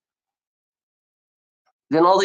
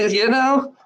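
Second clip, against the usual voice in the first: usual speaking voice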